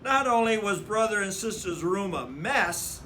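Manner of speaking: ordinary delivery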